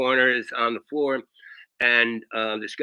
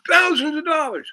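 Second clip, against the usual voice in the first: screaming doctor; 6 dB louder than usual. Retold or imitated. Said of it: "He's screaming at me".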